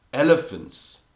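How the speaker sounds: ordinary delivery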